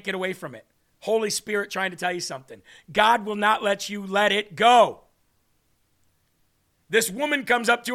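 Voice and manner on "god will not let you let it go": normal talking voice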